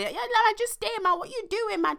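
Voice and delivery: high-pitched mimic of a friend; imitated friend. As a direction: squeak it up like your mate.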